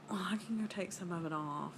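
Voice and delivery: small hurt whisper. Retold or imitated. Wife answering husband in a hurt whisper. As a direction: breathe it out, nearly in tears. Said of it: "I said".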